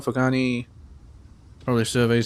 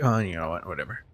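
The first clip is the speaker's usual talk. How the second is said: ordinary talk, nothing marked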